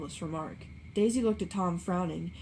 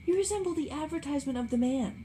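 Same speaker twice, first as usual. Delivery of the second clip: higher, dreamy innocent tone; Daisy musing to Gatsby; innocently; 8 semitones higher than usual. A narrator's reading. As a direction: say it artlessly, lost in him. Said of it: "she went on innocently".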